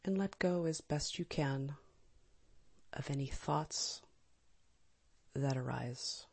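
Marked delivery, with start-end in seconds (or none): none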